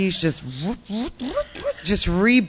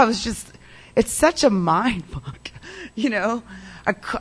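usual speaking voice

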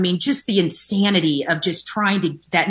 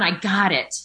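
ordinary delivery

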